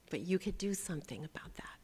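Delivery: quick whispered aside; fast whispered encouragement; whispered urging. Acted. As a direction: hush it fast to them.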